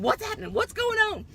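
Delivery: high, loud panicked questions; worried parents shouting down the line; frantic parents. Retold or imitated. Parents on the phone to their daughter, high loud panic. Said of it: "they're asking".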